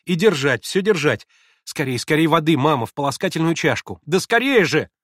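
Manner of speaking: взволнованно и громко; торопить мать в испуге; нервно. Acted. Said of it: "Нервно закончила она". (нервно и громко)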